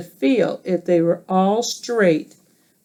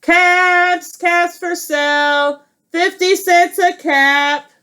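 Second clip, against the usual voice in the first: peddler calling wares; 8 semitones higher, slower than usual. Acted.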